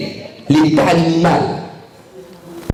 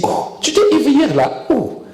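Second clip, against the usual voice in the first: Satan's jeering mock shock; 7 semitones higher than usual. Satan reminding someone of last night's drinking, mocking. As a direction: feign scandal, pitched up and teasing. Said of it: "il se moque même de toi, il rigole".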